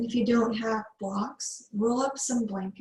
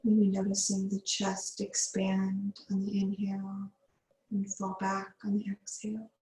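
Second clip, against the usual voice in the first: guided meditation. Acted. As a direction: ease the class into calm breathing. Yoga teacher leads breathwork.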